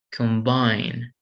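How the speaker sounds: "Combine" stressed on the last syllable, com-BINE; second-syllable stress. Shown as a demonstration. Teacher demonstrating a verb, "Combine" stressed on BINE. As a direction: put the weight on BINE in "Combine". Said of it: "if you stress the second syllable".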